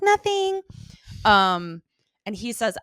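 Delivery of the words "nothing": very high, louder singled-out word; mock innocent. Retelling her denial when he asks, mock innocent. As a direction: play innocent in a high put-on voice. Voices Jenny feigning innocence.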